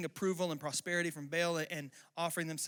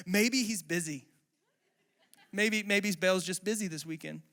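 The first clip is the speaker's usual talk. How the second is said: mocking taunt; 2 semitones higher, 6 dB louder than usual. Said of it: "making fun of these guys".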